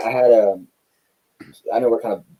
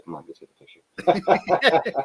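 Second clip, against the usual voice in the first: laughing give-in; 11 semitones higher, much slower than usual. Retold or imitated. Laughing while giving in.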